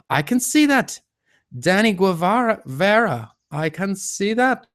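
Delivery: high, loud excitement; delighted discovery. Real, not acted. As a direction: exclaim as if it finally works. Delighted that a comment appears.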